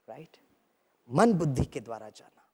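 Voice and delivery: ordinary delivery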